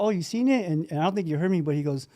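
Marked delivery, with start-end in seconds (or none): 0.0-0.7 surprised. Retold or imitated. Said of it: "And I was like".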